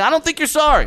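high-pitched disbelieving comeback; retold exasperated objection; indignant retort. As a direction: shoot it back in protest, pitched high. Retold quarrel with the boss.

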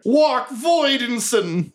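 high, slow, drawn-out goblin call; goblins crying out. Acted. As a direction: wail it out as the goblin crowd. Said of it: "From outside you hear".

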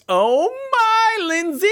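high mock gasp of delight; mock surprise. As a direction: squeal her name in fake amazement. Host hands over to pirate.

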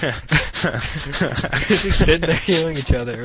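high, loud, slow goofy voice; goofy drawn-out mock voice; mock whiny medic. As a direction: ham up a helpless medic. Mocking two useless medics.

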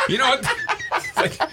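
high, slow words squeezed out through laughter; can barely get it out for laughing; laughing hard. Real, not acted. Man cracking up at his old team's lopsided loss.

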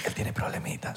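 hushed teasing whisper. Real, not acted. Host whispering a jab at his co-host, hushed. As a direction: whisper it behind the co-host's back. Whispered aside between hosts.

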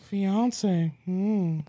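high, musing old man impression; lightly voiced scheming old neighbor; intrigued old man. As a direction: mull it over as a creepy old man. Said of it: "He'd be like".

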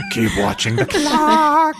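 sing-song monster slogan; creature voice. Pretend.

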